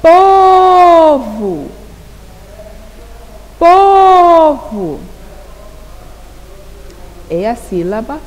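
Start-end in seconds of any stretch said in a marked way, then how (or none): 0.0-1.7 "Povo" called out, PO held long, high and loud; stretched stress on "Povo". Shown as a demonstration. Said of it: "pronuncio com mais intensidade". 3.6-5.0 "Povo" called out, PO held long, high and loud; stretched stress on "Povo". Shown as a demonstration. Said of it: "pronuncio com mais intensidade".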